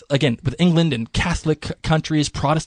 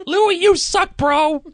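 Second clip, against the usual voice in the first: squeaky mock insult; slower than usual. Peasant insulting king.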